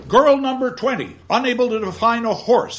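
high, loud pronouncement; stern announcement. Acted. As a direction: declare it to the class. Gradgrind condemns the girl.

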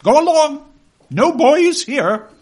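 put-on high, loud "Go away" from a fierce old lady; stern old aunt. Aunt driving the boy from her garden, loud high shooing. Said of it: "shaking her head".

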